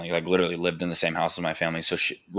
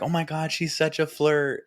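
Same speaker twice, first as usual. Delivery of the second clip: mock gushing; 9 semitones higher than usual. People remarking on his baby daughter, mock gushing. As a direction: mimic people gushing, pitched way up.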